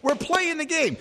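high, delighted victory cheer; excited cheer. Real, not acted. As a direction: celebrate the win with real joy. Host celebrates another correct guess.

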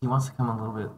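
soft, low off-mic murmur; hushed, low side conversation; discreet aside. Real, not acted. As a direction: relay it privately, under your breath. Relays private phone message quietly.

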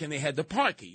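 ordinary delivery

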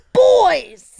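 huge high shouted streamer catchphrase; Ludwig. Shown as a demonstration. Podcaster showing off an impression to the table, shouted and hammed-up. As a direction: belt it out as an over-the-top streamer impression. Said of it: "you wanna see my Ludwig impression?".

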